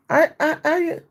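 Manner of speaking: high, stammering mock confusion; tongue-tied imitation. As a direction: imitate someone tripping over their words. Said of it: "She had a twisted, tied tongue".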